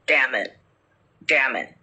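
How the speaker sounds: lightly deepened voice for an eerie parrot; deep, low voice. Acted. Parrot echoing a dead uncle, in a deep, low voice. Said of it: "he hears in a deep, low voice".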